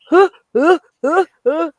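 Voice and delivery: high hiccuping sobs; mock sobbing. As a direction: sob like at a graduation. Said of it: "Don't judge me at the graduation".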